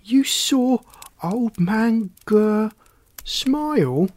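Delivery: high, drawn-out disbelief; loud, incredulous question; animated amazement. A narrator's reading. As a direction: stretch it out, astonished and high. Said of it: "Frog became very animated".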